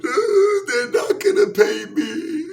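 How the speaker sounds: high, slow mock wail; crying. Old singer crying to Richard Pryor, hammed-up high wail. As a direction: whine it like a hammed-up sob. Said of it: "she starts crying".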